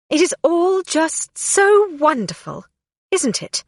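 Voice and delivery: high, sneering fake delight; mock the celebration bitterly; sarcastic. A narrator's reading. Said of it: "she thought".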